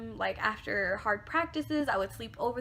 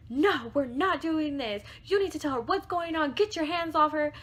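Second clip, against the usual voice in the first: raised, forceful protective scolding; retold going off; 5 semitones higher, 4 dB louder than usual. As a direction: lay into him as the protective best friend. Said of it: "You know, she's just going off".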